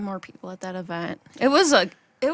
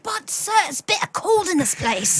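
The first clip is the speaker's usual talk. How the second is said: higher mock Victorian plea; pleading orphan; 8 semitones higher than usual. Worker pleading with the boss, hammed-up plea. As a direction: whine to the master about the cold.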